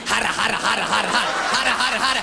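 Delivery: high, hammed-up lustful raving and yelps; frenzied grandma. Grandma fantasizing about the boy, frenzied high voice. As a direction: build to wild high nonsense cries.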